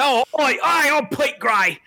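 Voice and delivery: high, slow, over-the-top Cockney; exaggerated high-pitched fake Cockney; mock Cockney accent. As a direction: tease with a wildly hammed-up Cockney squawk. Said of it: "I think you'd have a Cockney accent".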